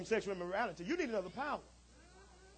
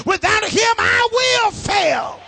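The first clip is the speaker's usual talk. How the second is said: loud, high, drawn-out plea; desperate prayer cry; 11 semitones higher, 14 dB louder, much slower than usual. Acted. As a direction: stretch out "fail" like a cry. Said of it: "That side of you makes you say".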